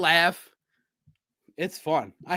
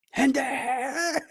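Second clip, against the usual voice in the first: mock scream; 7 semitones higher than usual. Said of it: "screamed it so loud".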